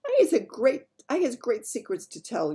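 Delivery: loud high eager giant; eager big friendly giant. Acted. Giant announces secrets.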